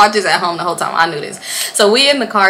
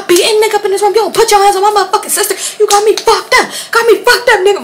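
high, rapid mock-furious rant; sister turned Hulk; 12 semitones higher, 4 dB louder, faster than usual. Sister defends her little sister, high mock-furious yelling. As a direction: yell it like a fired-up protective sister. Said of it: "She turned into the hawk".

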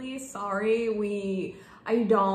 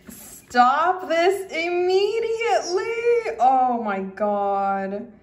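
loud, high, slow wail of delight; real joyful near-sobbing; overjoyed cry; 7 semitones higher, 8 dB louder, much slower than usual. Real, not acted. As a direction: cry out, overwhelmed with joy. Overwhelmed by gift.

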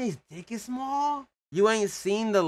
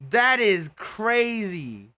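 ordinary delivery

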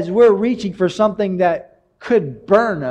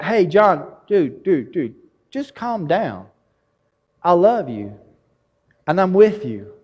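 God calming him; 4 semitones lower than usual. Acted.

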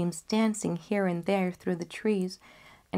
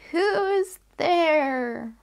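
put-on very slow, much higher frail old woman; grandmother's call; 10 semitones higher, 5 dB louder, much slower than usual. Grandmother answering the knock, slow and high. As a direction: quaver it out slowly and high.

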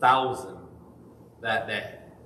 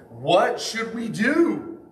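higher, slow and pleading; pleading question; 8 semitones higher, much slower than usual. Acted. Crowd asking Peter what to do, pleading. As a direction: ask it like a convicted crowd begging.

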